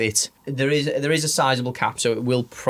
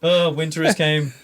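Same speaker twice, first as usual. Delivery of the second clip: jokey Scottish lilt; Scottish accent; 5 semitones higher than usual. Pretend. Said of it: "Is a Scottish accent".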